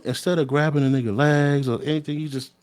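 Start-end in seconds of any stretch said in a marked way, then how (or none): none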